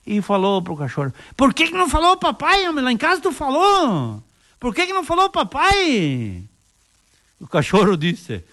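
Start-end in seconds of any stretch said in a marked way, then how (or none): 1.4-6.4 scolding the dog. Acted. Owner scolding dog on bus.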